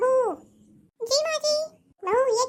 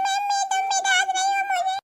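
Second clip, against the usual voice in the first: put-on whining child; 6 semitones higher than usual. Scolded boy to mother, hurt whine. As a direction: complain like a hurt little boy.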